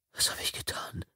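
horrified whisper to himself; stunned cop whispering. Acted. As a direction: breathe it out in shock. Shocked self-question.

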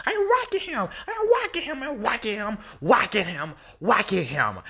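high, gloating repetition; gleeful Joker. Acted. Joker gloating to Batman about whacking Robin. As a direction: savour every blow, up high.

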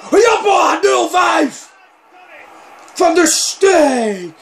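high, loud commentator's celebration; goal commentary shout. Real, not acted. Gamer announces a hat-trick, jubilant shouting. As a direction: bellow the scorer's name, drawn out.

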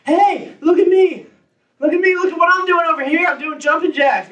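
put-on a kid begging to be watched; little kid voice. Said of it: "a little kid is standing over here going".

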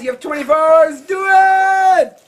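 loud, high rallying shout. Real, not acted. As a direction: fire up the nominees. Challenging nominees, fired up.

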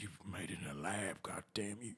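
hushed, breathy accusation; whisper. Acted. Cowboy hissing an accusation at the stranger, whispered. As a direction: whisper it like a secret curse.

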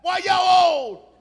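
put-on high child's holler to the front seat; yelling. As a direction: yell it hard, drawn out at the end. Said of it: "I yelled to the front seat".

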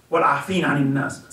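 quick chanted Arabic phrase; Quran recitation. Acted. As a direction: recite the verse in a swift flow. Quotes a Quran verse.

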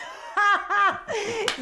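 high burst of laughter; loud laughing. Acted. Upset woman amused by her own joke, loud laughing. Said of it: "Γέλασα μες την πίκρα μου".

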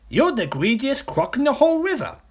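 high, loud little-croc outburst; accusing smaller crocodile. Acted. Smaller crocodile telling off the big one, high and indignant. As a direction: hurl it as a high-pitched accusation.

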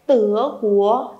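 "Tứa Quốc" slowly, both syllables rising; second tone. Shown as a demonstration. Teacher models the country name, rising second tone. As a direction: stretch "Tứa" and "Quốc", lifting each upward. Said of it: "tứa thanh hai lên cho cô".

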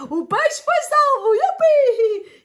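high loud cheer; joyful high yupi; excited cheer. Real, not acted. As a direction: celebrate happily. Cheer fish saved.

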